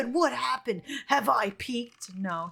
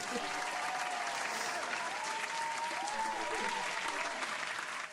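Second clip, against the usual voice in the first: long high laugh; 12 dB quieter than usual. Real, not acted. Reacting to a silly dating profile.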